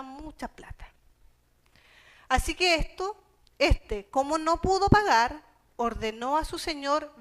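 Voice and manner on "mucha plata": "mucha plata" dropped to a soft murmur; quiet aside. Acted. Preacher echoing the debt to himself, a soft aside. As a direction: let "mucha plata" fall away, much softer.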